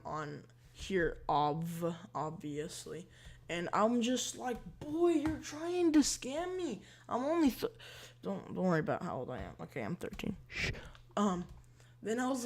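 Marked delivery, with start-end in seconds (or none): 4.8-7.7 indignant retort. Retold or imitated. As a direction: stretch it out high, calling out the scammer. Acted retort to scam bot.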